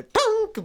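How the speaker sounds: very high, loud pop; mock crash sound effect; comic bang sound. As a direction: make a cartoon impact noise. Sound effect of car flipping.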